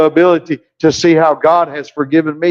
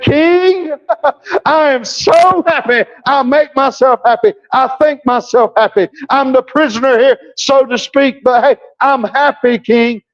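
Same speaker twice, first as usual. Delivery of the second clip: much higher, beaming, with a laugh; joyful Paul; 7 semitones higher than usual. Acted. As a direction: bubble over with happiness before the king. Acting Paul joyful before king.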